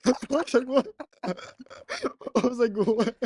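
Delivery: high, loud, laughing incredulous retelling; laughing disbelief. Real, not acted. Friend retelling a little girl's insult, laughing in disbelief.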